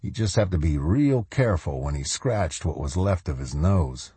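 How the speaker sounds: ordinary delivery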